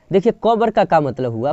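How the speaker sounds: usual speaking voice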